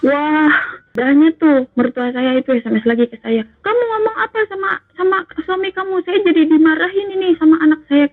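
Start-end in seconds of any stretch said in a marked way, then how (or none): 3.6-8.1 high-pitched imitation of an angry mother-in-law; mimicked telling-off, indignant and high; retold scolding. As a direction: scold accusingly, pitch jumping up. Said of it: "ibunya balik marahin lagi".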